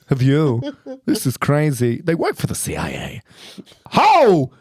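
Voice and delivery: odd pauses, then a sudden exclamation; Christopher Walken. Acted. Man shooing a pigeon from his kitchen, Walken impression. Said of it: "Christopher Walken trying to shoo a pigeon".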